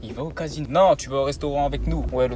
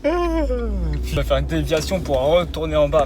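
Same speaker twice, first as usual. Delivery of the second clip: normal talking voice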